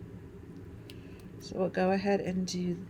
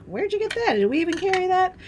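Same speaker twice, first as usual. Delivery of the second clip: higher, louder surprised questions; mock surprise; 11 semitones higher, 5 dB louder than usual. Crafter amazed at a customer's product, mock surprise. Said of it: "I'm like".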